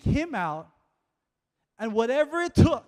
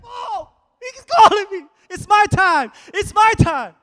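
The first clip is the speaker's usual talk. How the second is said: much higher, loud, elated cry; finally your moment, shout it; excited; 9 semitones higher, 8 dB louder than usual. Acted. Blind man realising Jesus calls him, in a high elated cry. Bartimaeus realises he's called.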